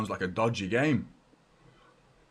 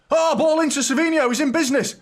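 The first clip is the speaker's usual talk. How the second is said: rising excitement, much higher and louder; excited commentary; 10 dB louder than usual. Real, not acted. Fan calling the attack live, an excited high, loud surge. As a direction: call it like the chance is on.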